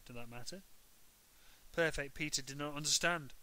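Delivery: ordinary delivery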